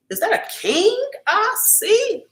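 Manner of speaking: playful high-pitched swoon; sing-song greeting. Acted. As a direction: croon it up high like flirting with royalty.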